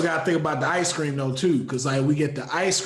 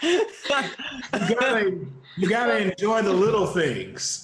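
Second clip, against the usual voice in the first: laughing; 7 semitones higher than usual. Real, not acted. Laughing while talking.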